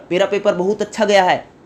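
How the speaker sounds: ordinary delivery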